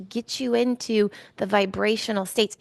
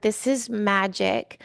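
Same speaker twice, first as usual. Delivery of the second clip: ordinary talk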